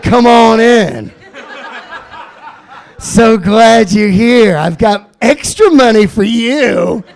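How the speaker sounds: slow, loud, creepy welcome; creepy stranger. Retold or imitated. Stranger luring the paperboy inside, slow creepy welcome. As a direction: lure the kid in slowly. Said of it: "how pedophile shit is that".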